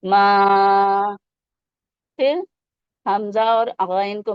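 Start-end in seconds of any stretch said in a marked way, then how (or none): 0.0-1.2 "ما" held for several counts, louder; "ما" drawn out; long madd. Shown as a demonstration. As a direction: stretch the a of "ما" long. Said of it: "three to five counts کھینچیں گے".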